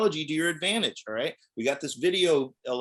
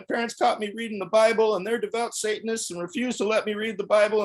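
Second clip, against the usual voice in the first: higher, louder put-on kid voice, earnest and pleading; fake pious boy; 9 semitones higher, 3 dB louder than usual. Prank caller to church phone line, as a pleading kid. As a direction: play a Bible-loving child begging for rescue.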